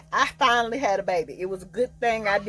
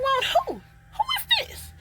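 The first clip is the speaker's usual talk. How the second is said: baffled surprise; much slower than usual. Retold or imitated. Stranger calls about her ex.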